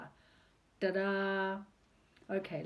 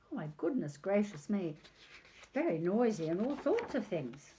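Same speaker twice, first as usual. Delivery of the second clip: plain reading voice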